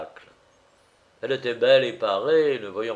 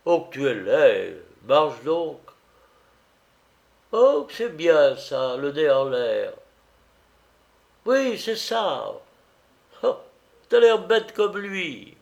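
laughing cook; 4 semitones higher, 5 dB louder than usual. A narrator's reading. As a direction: tease in a high, amused cook's voice. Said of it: "La rieuse cuisinière".

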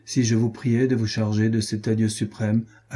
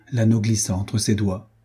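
ordinary delivery

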